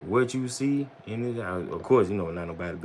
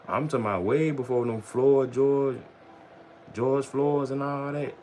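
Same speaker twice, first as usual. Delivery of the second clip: ordinary talk, nothing marked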